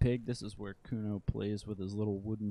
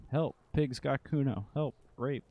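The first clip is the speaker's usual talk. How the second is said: put-on child shouting for help; 6 semitones higher, 3 dB louder than usual. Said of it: "the child shouts".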